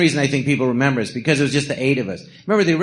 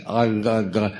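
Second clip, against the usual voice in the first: tongue-tied; 5 semitones lower than usual. Retold or imitated. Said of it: "and she's like".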